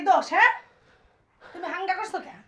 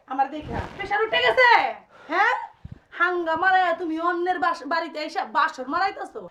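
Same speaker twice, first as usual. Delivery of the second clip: usual speaking voice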